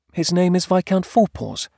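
high, light wife character; wife's voice. Acted. Wife introduces her kitten to husband, high-voiced.

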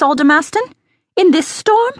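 high, astonished questioning; incredulous. A narrator's reading. Cook questioning the aldermaston about guests in a storm, incredulous. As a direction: leap up in pitch with disbelief.

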